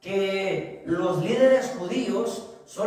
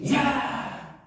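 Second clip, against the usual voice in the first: mock fierce shout; 6 semitones higher than usual. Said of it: "bien bravo".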